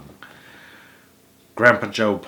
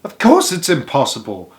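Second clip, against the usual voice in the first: cried out; 4 semitones higher, 6 dB louder than usual. A narrator's reading. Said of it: "cried Grandpa Joe".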